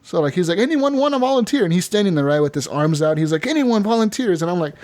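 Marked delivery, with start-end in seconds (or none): 0.6-1.6 high-pitched imitation of a stage magician; showman calling out. Magician asking the crowd for volunteers, calling out. 3.5-4.4 imitated magician's higher-pitched call; showman calling out. Magician seeking a volunteer from the crowd, calling out.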